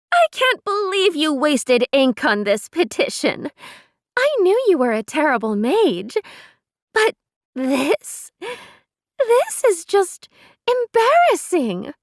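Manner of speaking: high, loud mocking proclamation; mock her for everyone to hear. A narrator's reading. Neris mocking the petition to the crowd, loud. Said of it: "raised her voice".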